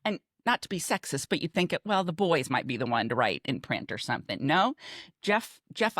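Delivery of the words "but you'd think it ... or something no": ordinary talk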